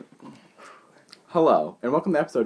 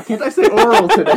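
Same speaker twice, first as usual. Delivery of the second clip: loud, high laughing complaint; cracking up mid-sentence at his own slip; 10 semitones higher, 10 dB louder than usual. Real, not acted. Host flubbing the intro, cracking up loudly at himself.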